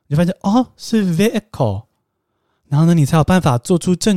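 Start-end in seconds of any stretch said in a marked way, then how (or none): 1.2-1.9 vehicle said slowly, with a silent h; correct pronunciation. Shown as a demonstration. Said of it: "真的听到是念vehicle". (正确读法vehicle，慢慢念，h不发音)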